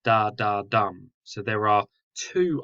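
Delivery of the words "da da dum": da da weak, dum hit hard; two light beats then one strong; anapestic beat. Shown as a demonstration. Teacher showing the anapest, stress on the final dum. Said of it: "This actually goes".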